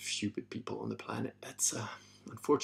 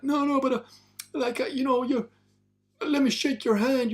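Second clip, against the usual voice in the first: high, loud pleading fan; mocking eager fan; 7 dB louder than usual. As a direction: beg to shake his hand in a silly high voice. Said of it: "And he's like".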